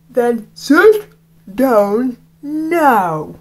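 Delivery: very slow, stern teacher's order. Acted. Teacher orders Rafe to sit down, very slow and stern. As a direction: spell out each word as a command.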